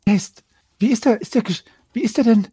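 put-on high, theatrical, breathless girl; hammy stage actor. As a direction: overplay the shock like melodrama. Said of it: "in einer theatralischen Geste".

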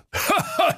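high, gleeful cackle; mocking high laugh. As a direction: laugh right in his face. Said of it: "bog sich vor Lachen". (hämisches Gelächter)

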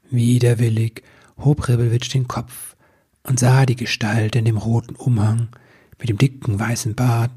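plain reading voice

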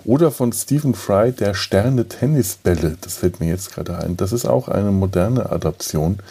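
usual speaking voice

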